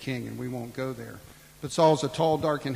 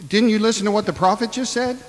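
much higher, incredulous rebuke; exasperated; 7 semitones higher, 5 dB louder than usual. Acted. Preacher rebuking Gideon, high exasperated disbelief. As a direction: scold him in disbelief. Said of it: "And I want to say".

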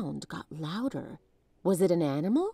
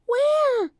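put-on much higher, louder girl's cry; frightened cry; 7 dB louder than usual. A narrator's reading. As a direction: cry out in panic. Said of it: "cried Annie".

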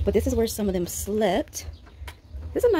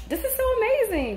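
excited; 13 semitones higher than usual. Real, not acted. Bursts out in delight.

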